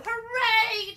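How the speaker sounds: high, loud hooray; cheering. A narrator's reading. Animals cheering as colors return, loud hooray. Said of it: "cheered the animals".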